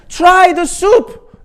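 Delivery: very high, loud and slow; old man's desperate drawn-out yell; drawn-out scream. Retold or imitated. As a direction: stretch every word in a shriek. Eric at peak.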